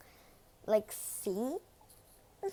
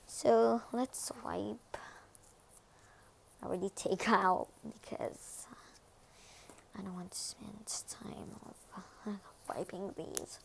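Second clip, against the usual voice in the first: ASMR whisper; 6 dB quieter than usual. Acted. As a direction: breathe the words, no voice.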